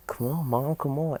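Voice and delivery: put-on high-pitched pleading kid; eager child. Izuku urging his mom on, eager child. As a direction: play a boy urging someone along, pitched well up.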